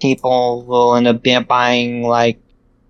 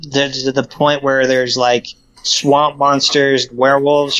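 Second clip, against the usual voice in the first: normal talking voice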